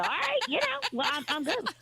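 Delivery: high, amused all right; amused reply. Retold or imitated. Owner answering customers who eat schmear plain, high and amused. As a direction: lift it up, tickled. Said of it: "And I go".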